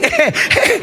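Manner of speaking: a high laugh breaking into the retold call. Real, not acted. Father laughing as he tells of losing his son. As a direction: laugh through the words.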